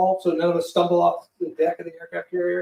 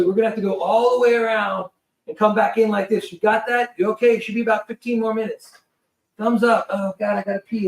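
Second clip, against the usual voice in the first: imitated crewman, pitched up and deliberate; acted instructions, higher and emphatic; crewman briefing; 4 semitones higher than usual. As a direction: get it across over engine noise. Crewman shouting briefing over noise.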